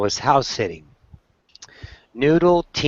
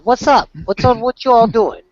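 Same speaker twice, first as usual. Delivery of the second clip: Sister; 12 semitones higher, 5 dB louder than usual. Retold or imitated. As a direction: voice a dog as a high-pitched sassy woman.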